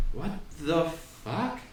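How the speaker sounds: quietly baffled, word by word; baffled disbelief. Retold or imitated. Reacting to sight in anecdote.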